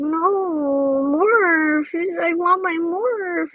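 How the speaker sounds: put-on a kid about to cry for his toy; whiny crying child. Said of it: "I'm like".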